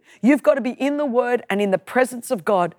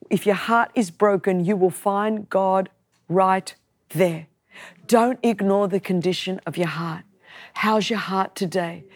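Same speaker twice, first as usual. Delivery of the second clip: tender pastoral close; 5 semitones lower than usual. Acted. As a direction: ease the hurting listener down gently. Tender pastoral close over music.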